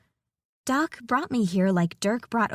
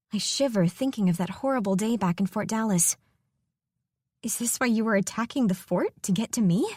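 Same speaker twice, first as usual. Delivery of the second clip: ordinary delivery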